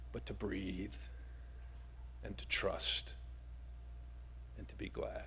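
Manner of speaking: fading into a whisper; hushed close. Acted. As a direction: let the sermon settle into breath. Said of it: "sermon close, hushed".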